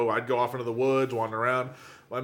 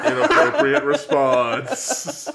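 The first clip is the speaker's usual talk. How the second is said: laughing; 11 semitones higher, 5 dB louder, much slower than usual. Real, not acted. Laughing at odd segue.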